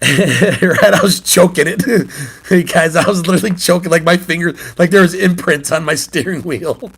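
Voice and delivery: chuckling through the words; real laughter. Real, not acted. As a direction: tell it while cracking up. Laughing at his own story.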